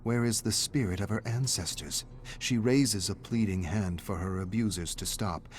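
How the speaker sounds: usual speaking voice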